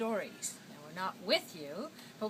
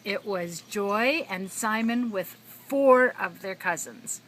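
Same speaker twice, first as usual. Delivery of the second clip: plain reading voice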